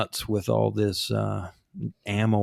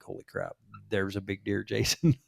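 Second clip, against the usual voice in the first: retold low-voiced gasp at a big buck; softly breathed, trying not to shout; hushed excited whisper; 3 semitones lower, 7 dB quieter than usual. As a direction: keep it down but thrilled, don't spook the deer. Spotting a big deer from truck.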